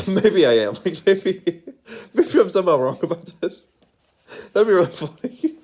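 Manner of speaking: amused, breaking into laughter; laughing. Real, not acted. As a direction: laugh through the words. Giggles at own possible error.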